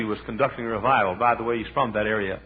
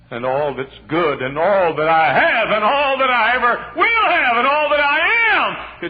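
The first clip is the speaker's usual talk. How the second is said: rising preacher's cry; 12 semitones higher, 9 dB louder, slower than usual. Acted.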